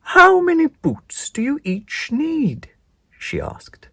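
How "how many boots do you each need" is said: high, slow, grand godmother voice; high spider godmother. Acted. Hairy godmother introducing herself to Spinderella, slow and grand. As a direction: announce yourself slowly like a magical godmother.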